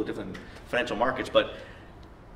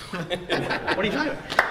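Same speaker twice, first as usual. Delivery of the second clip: higher, slower, laughing disbelief; incredulous laugh; 6 semitones higher, slower than usual. Retold or imitated. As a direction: stretch it out in amused shock. Repeats the question, more disbelief.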